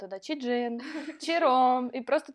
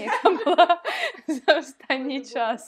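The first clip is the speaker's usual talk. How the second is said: laughing; 4 semitones higher, 7 dB louder, slower than usual. Real, not acted. Laughs at podcast as PR.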